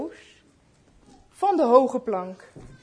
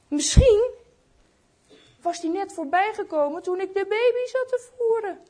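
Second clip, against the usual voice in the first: mildly anxious, pitch climbing high; growing worry; 7 semitones higher than usual. A narrator's reading. Old shoemaker fears he missed Jesus, anxious and higher-pitched. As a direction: let the worry build as the thought sinks in. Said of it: "begon papa Panov bang te worden".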